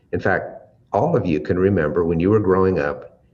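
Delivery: ordinary talk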